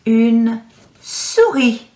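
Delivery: souris said suri, with no S at the end; slow, drop the final S in souris; French pronunciation. Shown as a demonstration. Teacher modelling une souris slowly for children. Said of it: "does have a silent S again".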